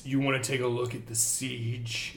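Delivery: low, quiet menacing ogre; threatening ogre. Acted.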